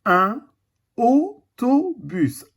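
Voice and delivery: autobus drawn out very slowly; slow teaching word. Shown as a demonstration. A teacher showing the class autobus, very slowly. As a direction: stretch each syllable of autobus. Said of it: "Le son O".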